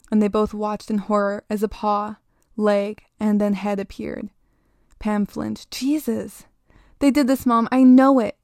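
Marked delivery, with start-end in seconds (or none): 5.7-8.4 narrator's contained cry, building louder; upset child's accusing outcry; mild scream. A narrator's reading. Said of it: "Jamie screamed".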